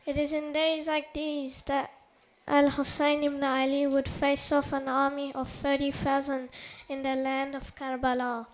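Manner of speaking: loud, forceful oratory; formal speech. Acted. Speaker honoring the Prophet's family before the gathering, loud declamation. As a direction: project a solemn speech to the whole hall. Delivers prepared speech after quiet introduction.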